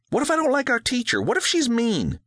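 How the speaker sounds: put-on high, fast fretting girl; anxious daughter asking quickly; worried little girl. A narrator's reading.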